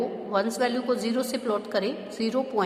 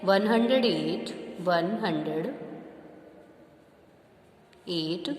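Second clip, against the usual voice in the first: slow dictation; 4 semitones lower, slower than usual. Shown as a demonstration. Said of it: "फिर point आ गया ना".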